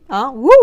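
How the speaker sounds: high squealing whoop and laugh; playful wouh and giggle; mock magic whoop.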